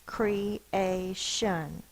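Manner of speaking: "Creation" tapped out in three separate beats; syllable by syllable. Shown as a demonstration. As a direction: slowly split "Creation" into cre, a, shun. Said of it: "If you were to tap it out".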